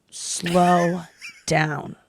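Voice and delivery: imitating a very deliberate warning, each word held long; drawn-out slow down. Surgeon warning a fast-talking patient, very slowly. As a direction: stretch SLOW and DOWN far apart. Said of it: "Right away, I gotta tell you".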